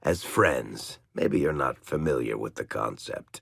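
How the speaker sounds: ordinary talk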